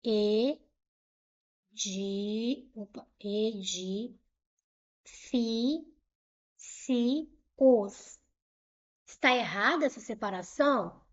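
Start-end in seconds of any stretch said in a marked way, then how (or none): 0.0-2.7 "E de" as two isolated syllables with a long gap. Shown as a demonstration. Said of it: "Eu pensei em separar assim". 3.2-8.2 "e de fi si os" in slow, separate syllables. Shown as a demonstration. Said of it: "Eu pensei em separar assim".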